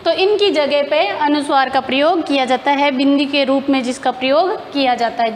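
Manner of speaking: ordinary delivery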